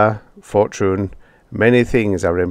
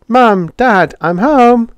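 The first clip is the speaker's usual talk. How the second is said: put-on high, loud boy's voice calling through the house; Johnny calling out; 7 dB louder, slower than usual. A narrator's reading. Boy calling for his parents on arriving home, high and loud. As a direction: call out like a young boy coming home. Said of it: "Johnny called".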